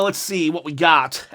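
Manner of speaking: ordinary talk, nothing marked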